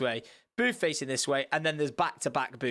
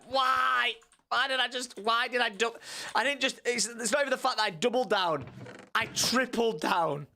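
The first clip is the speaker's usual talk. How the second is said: higher anguished whine; self-reproach; 6 semitones higher than usual. Retold or imitated. After leaving the restaurant, whining in regret. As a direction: whine at yourself for doubling down.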